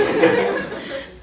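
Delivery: loud, high laugh; burst of laughter. Real, not acted. Student retelling the reunion, cracking up.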